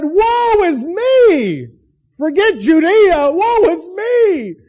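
high, loud, very slow wail of woe; anguished woe. Retold or imitated. Preacher voicing Isaiah's despair, high and slow. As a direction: cry it out like a man undone.

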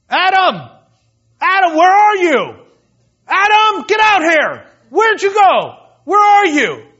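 loud, high furious yelling for Adam; angry shouting God. Retold or imitated. God stomping through the garden, shouting angrily for Adam. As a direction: bellow his name like a stomping, angry father. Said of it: "he's kinda stomping through the garden".

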